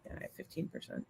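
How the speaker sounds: whispering. Real, not acted. Side remark under breath.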